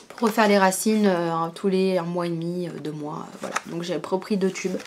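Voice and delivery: ordinary talk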